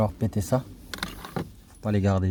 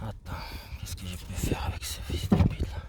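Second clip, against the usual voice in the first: whispered swear at the motor; cursing under breath; 3 semitones lower, 5 dB quieter than usual. Real, not acted. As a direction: curse it quietly under your breath. Stuck, swearing at the part.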